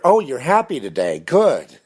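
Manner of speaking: a hint of a chirpy passerby; cheery stranger. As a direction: stranger greeting the narrator, mildly mock-cheery. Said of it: "a random stranger".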